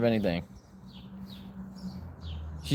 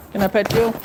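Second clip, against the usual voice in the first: much higher sweet talk to a pet; 12 semitones higher than usual. Real, not acted. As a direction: coo at the dog in a high voice. Baby talk to dog.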